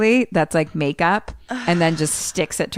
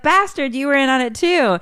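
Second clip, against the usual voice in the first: mock accusing; 9 semitones higher than usual. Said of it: "they're like".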